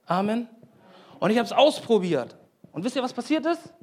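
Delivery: ordinary talk, nothing marked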